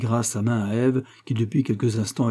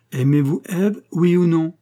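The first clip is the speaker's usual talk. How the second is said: raised, loud, dry interruption; curt demand; 7 semitones higher, 6 dB louder than usual. A narrator's reading. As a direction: cut in and demand an answer. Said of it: "interrompit sèchement madame Dalvincourt". (ton sec, fort et haut)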